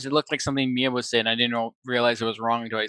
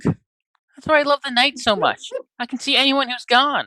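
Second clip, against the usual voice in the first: sheltered daughter character; 12 semitones higher, 4 dB louder than usual. Acted. Daughter praising her late dad, high girlish gush. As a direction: play a naive daughter adoring her father.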